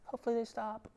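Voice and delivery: soft, passive mumble to himself; a quiet wishful mutter, doing nothing; timid hopeful murmur. Retold or imitated. A dad quietly hoping his kids stop, soft mutter. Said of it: "it's not good for me to be like".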